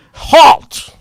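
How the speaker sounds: sharp high barked halt; shouted command. Retold or imitated. Guard shouting at the car to halt. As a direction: stop them cold with one word.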